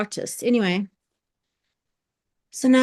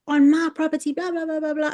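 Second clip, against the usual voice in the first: mock angry shout; 6 semitones higher, faster than usual. It was like a real altercation.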